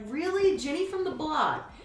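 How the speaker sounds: high, loud disbelieving question; shocked double-check, voice jumping high; astonished reply. Retold or imitated. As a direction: retell it with incredulous pitch leaping up. Acts out own astonished reply.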